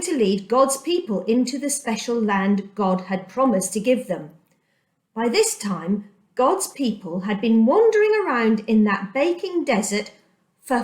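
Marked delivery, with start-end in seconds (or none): none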